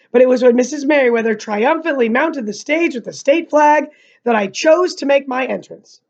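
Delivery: ordinary delivery, nothing marked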